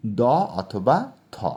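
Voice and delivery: দ অথবা থ spelled out slowly, each sound held; slow sound demo. Shown as a demonstration. Teacher drawing out দ অথবা থ for the class. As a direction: say দ and then থ very slowly and clearly. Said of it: "টিএইচ, that is".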